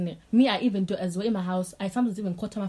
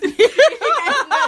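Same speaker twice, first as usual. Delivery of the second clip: loud squealing laugh, much higher; high shrieking laugh; 11 dB louder than usual. Real, not acted. Friend teases about Tutalicious.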